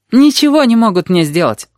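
put-on high-pitched confident girl; confirming. A narrator's reading. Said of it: "подтвердила Рыжая".

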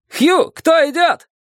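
very high, drawn-out call; stretched high storybook shout; shouted challenge. A narrator's reading. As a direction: hail the ferryman from afar. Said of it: "крикнул незнакомец".

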